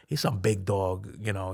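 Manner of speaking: normal talking voice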